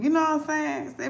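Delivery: usual speaking voice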